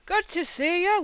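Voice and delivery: imitated hearty hello to an old pal; familiar, friendly greeting. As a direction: greet like a long-time friend, high and loud. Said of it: "talking as if he's known you".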